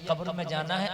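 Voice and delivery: ordinary delivery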